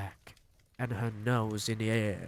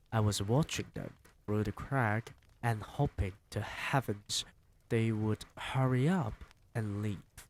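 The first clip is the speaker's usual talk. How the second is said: usual speaking voice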